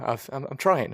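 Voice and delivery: normal talking voice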